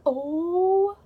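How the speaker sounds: loud, high, stretched-out oh; shocked gasped oh. Retold or imitated. Reacting to a man being stabbed, shocked cry. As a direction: let the oh soar in horror.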